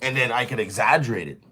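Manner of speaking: usual speaking voice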